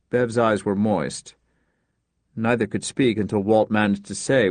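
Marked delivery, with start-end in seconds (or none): none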